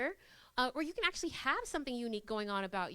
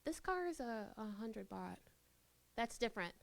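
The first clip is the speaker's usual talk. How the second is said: much softer, unsure; hesitant price quote; 4 semitones lower, 9 dB quieter than usual. Shown as a demonstration. Seller naming the car price, softer and unsure. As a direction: mumble the price as if doubting it. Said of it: "They feel differently than".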